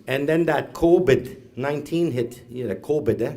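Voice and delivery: ordinary talk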